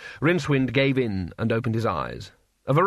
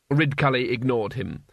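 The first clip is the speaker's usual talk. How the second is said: ordinary talk, nothing marked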